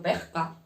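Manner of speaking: "pesca", s turned into a throaty breath; aspirated s. Shown as a demonstration. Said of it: "en vez de la S utilizan la J".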